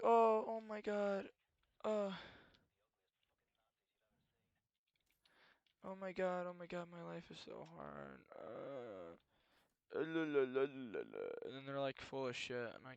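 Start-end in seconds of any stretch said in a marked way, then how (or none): 0.0-11.2 mock a dramatic complainer with a fake cry; mock self-pity. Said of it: "people always like".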